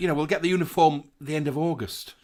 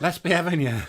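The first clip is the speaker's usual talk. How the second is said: laughing echo; 4 semitones higher, 5 dB louder than usual. Acted. Echoing the put-on voice laughing.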